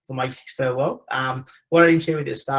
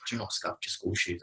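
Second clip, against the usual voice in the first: mum's hushed warning, lightly imitated; low, soft word in the ear; retold whisper; 4 semitones lower, 9 dB quieter than usual. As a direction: drop the voice as if whispering to a child. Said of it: "my mum whispered in my ear".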